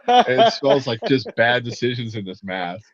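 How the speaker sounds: normal talking voice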